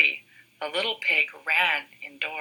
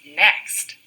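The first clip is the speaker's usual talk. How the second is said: plain reading voice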